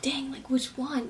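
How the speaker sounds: slow anxious whisper; whispered panic. Retold or imitated. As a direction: whisper it under your breath, worried. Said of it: "I was like".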